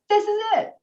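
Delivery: high, excited mock gasp of discovery; mock excitement. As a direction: squeal it like finding treasure. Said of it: "you go over there like".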